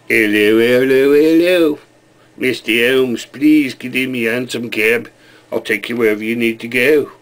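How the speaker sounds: hammed-up London cab driver; London cabbie accent. Cabbie offering Mister Holmes a hansom cab, London accent. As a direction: play an old-fashioned London cabbie, English accent.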